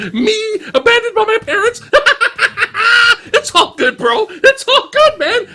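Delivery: a monk too happy to be upset by anything, cackling; giddy happy monk. Retold or imitated. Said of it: "the happiest monk in cinema".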